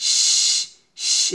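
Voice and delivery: শ as a long voiceless hiss. Shown as a demonstration. Teacher models the sound of shin, hissing শ. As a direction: let only air out on a sustained শ. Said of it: "এভাবে".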